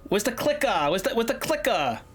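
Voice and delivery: Boston accent with "clicker" ending in "-ah"; Boston accent demo. Shown as a demonstration. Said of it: "we don't say our R's".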